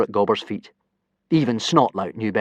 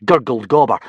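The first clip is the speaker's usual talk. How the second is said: ordinary delivery, nothing marked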